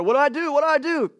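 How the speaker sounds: high, rising frantic questions; mock-panicked repeated plea; acted panic. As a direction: fret aloud as if overwhelmed. Acts out panic at dangerous world.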